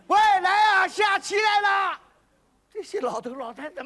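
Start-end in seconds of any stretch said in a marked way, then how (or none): 0.1-2.0 吆喝招呼. Acted. 招呼老人们来下棋.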